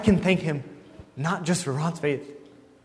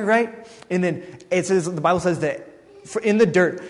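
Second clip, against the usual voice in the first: ordinary talk, nothing marked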